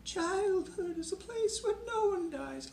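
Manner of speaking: high, quavering, tearful poet's reading; high, weepy, old-fashioned recital of one line; imitating Millay's reading. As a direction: copy Edna St. Vincent Millay's dramatic recital voice. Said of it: "hear one of her readings".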